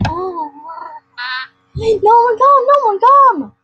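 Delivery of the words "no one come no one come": shout. Real, not acted. Yelling at no-shows.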